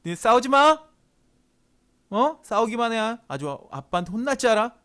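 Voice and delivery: acted angry telling-off; scolding dad. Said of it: "어떨 때는 어때요?".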